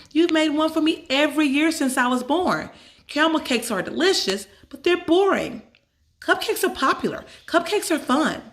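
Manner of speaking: put-on a girl talking her mother into cupcakes; eager girl. A narrator's reading. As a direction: cupcakes are popular, cupcakes are fun, said with bright insistence. Emily arguing for cupcakes.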